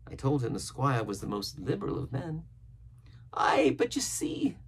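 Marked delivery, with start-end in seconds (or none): 3.3-4.6 high, slow castaway voice; Ben Gunn. A narrator's reading. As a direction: stretch it out in a high-pitched character voice. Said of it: "returned Ben Gunn".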